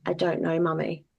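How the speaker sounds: put-on soft, sheepish kid's reply; a child's quiet shrug of a reply. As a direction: mumble it like a caught-out child. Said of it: "they just go".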